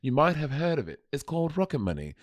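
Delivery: mock-British presenter, hushed ad read; British accent. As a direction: do a British TV show voice. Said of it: "I'll do British show".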